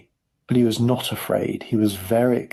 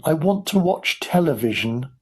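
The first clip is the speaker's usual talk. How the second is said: put-on mild, higher boy's protest; protesting shout; 6 semitones higher than usual. A narrator's reading. Boy demanding television, mild shout. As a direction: protest insistently without much volume. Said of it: "Mike started shouting".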